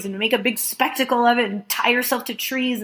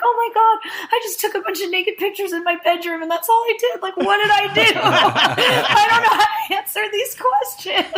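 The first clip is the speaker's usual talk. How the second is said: higher, laughing in disbelief; high giggling exasperation; flustered laughing; 10 semitones higher than usual. Retold or imitated. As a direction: play it overwhelmed and giggly. Her flustered answer, laughing.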